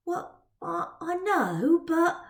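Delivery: a hint of a choked girl; hesitant, halting girl; lightly voiced Twill.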